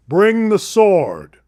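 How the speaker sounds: repeating King Solomon's command with relish; commanding king again. Retold or imitated. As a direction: savor the king's order slowly. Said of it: "He goes".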